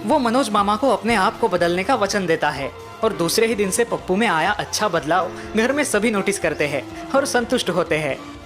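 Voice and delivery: ordinary delivery, nothing marked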